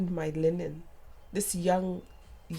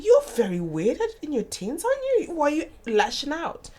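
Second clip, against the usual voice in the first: puzzled mum; 7 semitones higher, 4 dB louder than usual. Retold or imitated. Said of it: "I'm thinking".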